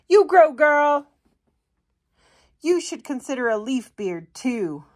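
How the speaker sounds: cheering woodland plant voice; sassy cheering plant. Acted. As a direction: hype her up like a best friend.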